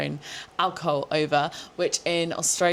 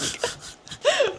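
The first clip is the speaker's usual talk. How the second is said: much higher breathless squeal of laughter; a high giggling squeal. Real, not acted. Laughing at the funny mix-up, a high squeal.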